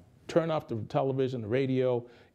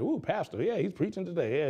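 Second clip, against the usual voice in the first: high, delighted churchgoer's cheer; mock churchgoer thrilled by the sermon; excited churchgoer; 6 semitones higher than usual. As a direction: cheer the pastor on from the pew. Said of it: "you're excited".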